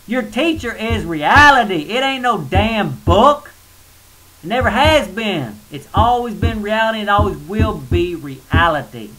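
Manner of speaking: loud, slow, forceful declaration; heated preaching. Real, not acted. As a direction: pound each word like a preacher. Ranting against religious books.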